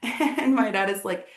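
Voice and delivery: ordinary delivery, nothing marked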